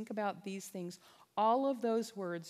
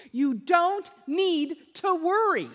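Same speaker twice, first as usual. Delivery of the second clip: loud reassurance; 8 semitones higher, 12 dB louder, slower than usual. Real, not acted. Preacher reassures congregation loudly.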